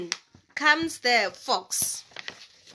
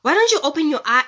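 irritated; 4 semitones higher, 6 dB louder, faster than usual. Acted. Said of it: "the rabbit is just irritated".

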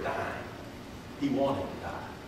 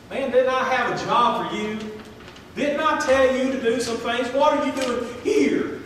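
higher, louder scolding God voice; God rebuking Elijah; 8 semitones higher, 5 dB louder than usual. Retold or imitated. God rebukes runaway prophet, stern and loud. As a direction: demand it like a disappointed father. Preacher voicing God's rebuke.

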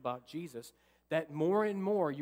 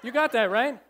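usual speaking voice

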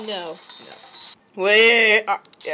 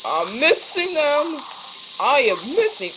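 frustrated whine; 4 semitones higher, 5 dB louder than usual. Real, not acted. Frustrated at missing in game.

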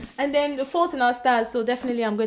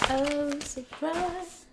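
mock surprise; 4 semitones higher, 6 dB quieter, much slower than usual. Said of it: "we have to be surprised".

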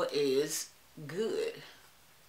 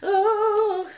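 long high loud "ooh"; drawn-out ooh; 13 semitones higher, 9 dB louder than usual. Real, not acted. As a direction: let out a sustained, high-pitched ooh. Exclaims while struggling with hair.

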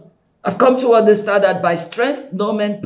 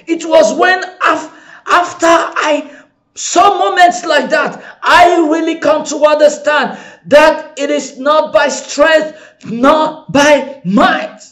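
preaching shout; 5 semitones higher than usual. Real, not acted. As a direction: drive it home to the congregation. Preaching at full force.

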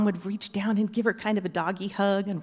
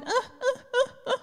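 high whimpering dog whine, much higher than her voice; imitated dog whine. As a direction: whimper like an eager dog waiting at the door.

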